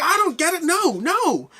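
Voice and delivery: high, loud horrified protest; mock horror. Crowd member recoiling from the robot, high mock horror. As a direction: back away aghast, hammed up. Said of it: "backing off in horror".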